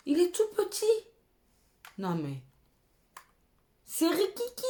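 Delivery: high, outraged at how tiny it is; mock outrage. Real, not acted. Showing viewers the tiny blush, high outraged squeal.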